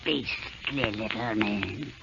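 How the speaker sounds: low, soft hateful aside; spiteful mutter. Acted. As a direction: grumble it under her breath. Witch curses dwarves aside.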